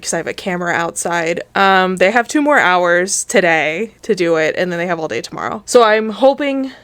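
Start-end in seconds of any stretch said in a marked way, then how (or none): none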